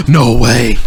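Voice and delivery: sharp gasp, then loud amazed outburst; excited gasp. Retold or imitated. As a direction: re-enact gasping in thrilled disbelief. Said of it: "it wasn't so much a squeal. It's more just like".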